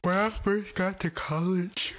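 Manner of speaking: words stretched through a big yawn. Real, not acted. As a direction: talk mid-yawn, slow and drawn out. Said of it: "Sorry, y'all, heavy yawn".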